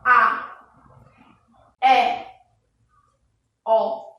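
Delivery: each vowel held open and clear, no pause; teacher's vowel demo. Shown as a demonstration. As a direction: sound out the open vowels A, É, Ó one by one. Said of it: "todas as vezes que eu pronunciei essas letras".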